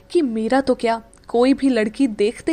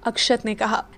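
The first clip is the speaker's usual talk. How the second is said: plain reading voice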